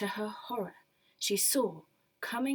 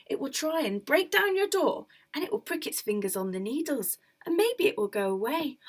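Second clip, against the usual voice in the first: higher, helpful advice; lightly voiced peddler; 5 semitones higher, 4 dB louder than usual. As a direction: explain the trick eagerly.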